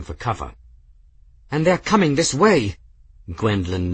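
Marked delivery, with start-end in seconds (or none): 1.5-2.8 high, louder warning; raised voice, tense with alarm. A narrator's reading. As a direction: point out approaching danger urgently. Gwen sees attackers approaching.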